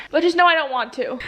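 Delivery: ordinary talk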